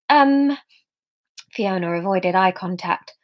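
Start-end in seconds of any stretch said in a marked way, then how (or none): none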